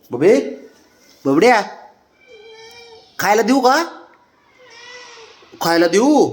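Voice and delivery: high pet talk. Real, not acted. Man greeting his excited dogs at the door, high sing-song pet talk. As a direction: gush over the excited dog in a high voice. Offering food to the dog.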